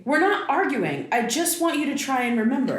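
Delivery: high, tight insistence; insistent frustration. Acted. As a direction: deny the fight while pressing hard. Daughter argues with her mother.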